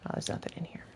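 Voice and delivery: very soft, voiceless aside; whisper. Real, not acted. Player finds an empty spot while searching, whispered. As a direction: whisper it under your breath to yourself.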